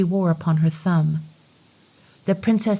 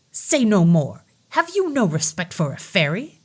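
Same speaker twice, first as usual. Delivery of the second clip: angry fairy. Acted. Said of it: "cried she angrily".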